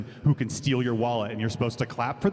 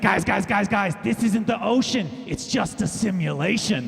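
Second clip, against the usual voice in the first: high, loud, urgent fish; a panicking fish warning the tank; frantic fish voice; 8 semitones higher, 5 dB louder than usual. Pretend. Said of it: "he's like".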